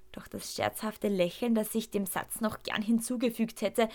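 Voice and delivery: ordinary delivery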